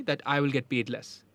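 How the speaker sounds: ordinary talk, nothing marked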